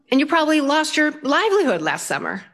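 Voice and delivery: high-pitched, mock-helpless plea; acted exasperated protest. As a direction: plead comically that you are only pretending. Imagined protest to an earnest farmer.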